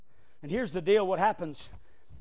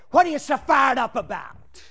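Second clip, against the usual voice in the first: a lightly voiced grumbling churchgoer, a bit louder; mock-quoting the people who complain about a fired-up preacher; mock complaining member; 5 semitones higher, 7 dB louder than usual. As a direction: whine it back at the preacher, slowing on "big deal". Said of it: "they say".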